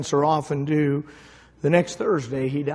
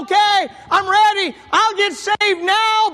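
mock cry; 6 dB louder than usual. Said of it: "I'm sure he cried out".

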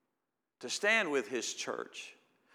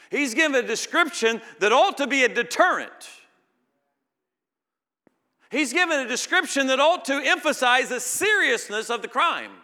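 much higher and louder preaching; full-force preaching; 12 semitones higher, 13 dB louder than usual. Acted. Preacher thundering at the congregation about the deterrent. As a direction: drive the warning home at full force.

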